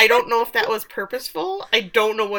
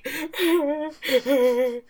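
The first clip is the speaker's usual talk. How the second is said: high-pitched pretend sobbing; hammed-up little boo-hoo sobs; mock crying; 7 semitones higher than usual. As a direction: imitate someone starting to cry. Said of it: "like, starts to cry".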